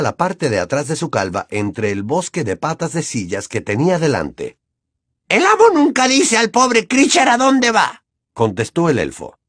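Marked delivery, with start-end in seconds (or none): 5.3-8.0 a sly, servile creature muttering to himself; creepy house-elf voice. Acted. Kreacher answers.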